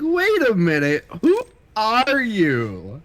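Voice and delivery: high, slow, baffled double take; mock confusion. Friend imagining meeting his stunt double, puzzled and high. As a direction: stretch out a puzzled who are you. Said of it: "I'd be like".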